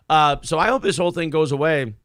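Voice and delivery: usual speaking voice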